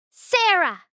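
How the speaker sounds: sharp loud shout of a name; loud call. A narrator's reading. Boss shouting across the lobby, loud. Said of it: "I hear a loud voice".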